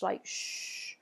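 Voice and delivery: long unvoiced hushing sh; just breathe out a soft sh; sh sound. Shown as a demonstration. Teacher demonstrates the sh sound. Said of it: "equivalent to our S H sound".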